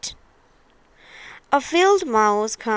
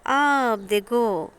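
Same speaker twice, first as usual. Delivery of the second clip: ordinary delivery, nothing marked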